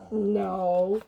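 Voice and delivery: plain reading voice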